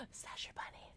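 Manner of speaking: whispered gasp of alarm. Real, not acted. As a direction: gasp it in fright. Gasp at monster.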